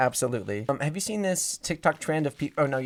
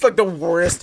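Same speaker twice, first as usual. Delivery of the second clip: ordinary talk, nothing marked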